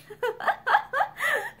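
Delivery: bright mocking laugh; high laugh. A narrator's reading. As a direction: laugh at the idea. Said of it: "Pansy laughed".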